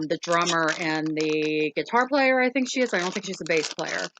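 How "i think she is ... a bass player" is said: usual speaking voice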